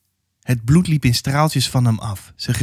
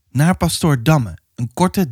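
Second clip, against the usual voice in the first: ordinary delivery, nothing marked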